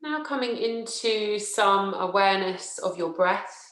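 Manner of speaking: slow, measured yoga nidra voice with long pauses; guided relaxation. Acted. Teacher settling listeners into yoga nidra, slow guided relaxation. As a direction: guide them unhurriedly into rest, leaving space after each phrase.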